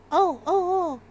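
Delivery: usual speaking voice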